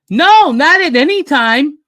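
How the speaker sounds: bouncy toon voice; cheeky cartoon rabbit. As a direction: toss off a gleeful pun. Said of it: "he's like".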